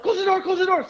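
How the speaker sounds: high, panicky retold plea; mock frantic urging. Storyteller urging passengers to shut him out, mock frantic. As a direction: urge it high-pitched, without shouting.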